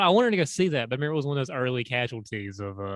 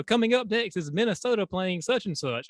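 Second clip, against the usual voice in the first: higher-pitched mock announcer; arena announcer; 7 semitones higher than usual. Retelling a Minnesota basketball game, mock announcer voice. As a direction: lightly imitate a big game announcement. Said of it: "they had a big announcement".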